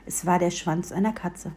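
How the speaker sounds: ordinary delivery, nothing marked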